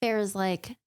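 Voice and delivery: normal talking voice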